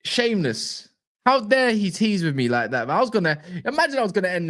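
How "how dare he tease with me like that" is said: ordinary delivery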